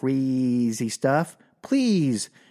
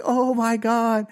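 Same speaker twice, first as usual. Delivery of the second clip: panicked oh my God; 8 semitones higher, slower than usual. Retold or imitated. Panicked drive home.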